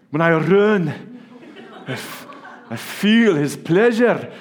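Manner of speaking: hammed-up Scottish brogue; Scottish accent. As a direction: roll it out like a proud Scot. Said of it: "I've always said this quote with a, like, a Scottish accent".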